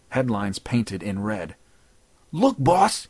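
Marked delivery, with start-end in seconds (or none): 2.3-3.1 breathless, higher and louder; out of breath henchman bursting in, louder; panting. Acted. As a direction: gasp it out after running in. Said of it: "he panted".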